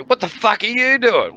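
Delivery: high, loud mock cop shout; police shouting at men on the truck roof; cop yelling. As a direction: bark it up at the drunks. Cops shouting back up.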